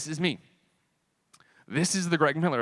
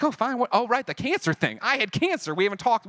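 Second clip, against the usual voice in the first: higher, offhand acted reply; breezy surprise; 8 semitones higher than usual. Replying to someone asking after his health, breezy mock surprise. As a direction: toss it off high, as if just reminded.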